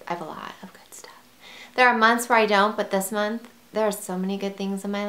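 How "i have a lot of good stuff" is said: quick, unvoiced whisper. Shown as a demonstration. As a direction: whisper it fast like a secret. Repeats the line, then whispers it.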